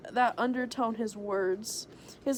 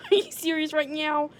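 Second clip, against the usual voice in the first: incredulous scoff, higher and louder; 6 semitones higher, 5 dB louder than usual. Acted. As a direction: scoff at him in disbelief. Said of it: "I asked him with a scoff".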